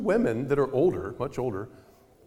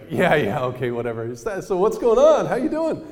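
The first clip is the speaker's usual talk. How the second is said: high, loud, breezy woman brushing it off; dismissive jogger; 5 semitones higher, 7 dB louder than usual. Retold or imitated. Jogging woman brushing off his denial, high and breezy. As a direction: wave away his denial and push on.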